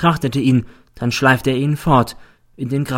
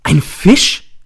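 higher, louder startled cry; cried out; 7 semitones higher, 8 dB louder than usual. A narrator's reading. Teacher leaps up in court, louder higher shocked cry. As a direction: burst out in shock. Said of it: "Ich springe auf und schreie".

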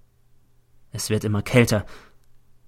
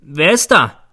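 raised, louder call; 5 semitones higher, 7 dB louder, slower than usual. A narrator's reading. Teacher calling through the door at night, loud. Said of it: "rufe ich durch die Türe".

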